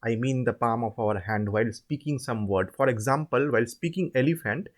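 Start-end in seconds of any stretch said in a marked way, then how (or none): none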